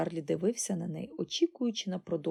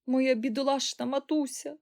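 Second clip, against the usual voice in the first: higher, mildly plaintive cry; lamenting; 6 semitones higher than usual. A narrator's reading. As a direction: lament over poor mother. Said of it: "оголосила пані Бакет".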